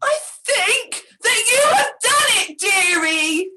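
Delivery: high, loud happy cry; gleeful shout. A narrator's reading. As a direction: shout it out with glee. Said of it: "gleefully let out a shout".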